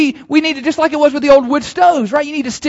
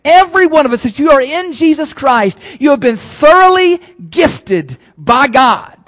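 rising, louder preacher's proclamation; preaching at full force; 4 semitones higher, 5 dB louder than usual. Acted. Pastor declaring to the church, preaching at full force.

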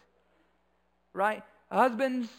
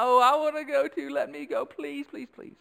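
higher, lightly voiced whining beg; imitated child's crying plea; 5 semitones higher than usual. Father imitating his son begging to go along, mock crying. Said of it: "Elijah began to cry".

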